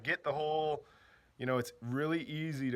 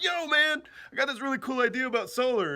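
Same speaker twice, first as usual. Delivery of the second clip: hyped friend; 10 semitones higher, 4 dB louder than usual. Retold or imitated. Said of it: "and he's like".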